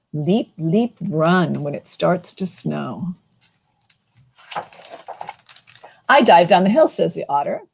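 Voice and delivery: lilting picture-book read-aloud; reading to children. A narrator's reading. Librarian reading a snow book to kids, lilting. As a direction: read it to a room of little kids.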